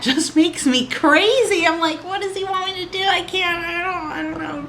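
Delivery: high, laughing, flustered rambling; giddy and flustered. Retold or imitated. Gushing to viewers about her crush, high and giddy. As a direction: bubble over, helpless and giddy. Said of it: "Oh, listen to me".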